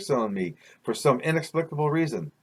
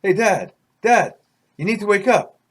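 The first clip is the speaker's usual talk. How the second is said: high, loud call to a sleeper; urgent higher-pitched wake-up call; 7 semitones higher, 5 dB louder than usual. Acted. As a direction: rouse him with insistent raised voice. Dream figure calls to wake dad.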